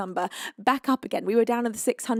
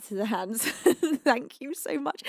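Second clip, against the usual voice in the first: normal talking voice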